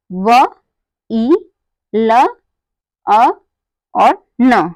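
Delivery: each sound of व, ई, ल, अ, न said separately. Shown as a demonstration. Teacher showing the sounds to be joined into a word, sounded out one by one. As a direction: break it into व... ई... ल... अ... न, pausing after each. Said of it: "इन सबको मिलाएंगे तो क्या बना".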